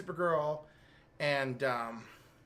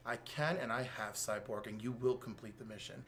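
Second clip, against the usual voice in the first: low, much softer, calm threat; cold menacing villain; 7 semitones lower, 9 dB quieter than usual. Retold or imitated. Eradicator coldly answering Cyborg Superman, low soft menace. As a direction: deliver the order with quiet, chilling control. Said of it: "and he's like".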